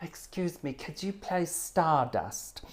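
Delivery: soft, slow mock-polite request; audience requester voice. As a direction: put on a timid punter asking for a song. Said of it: "they always say it in that voice".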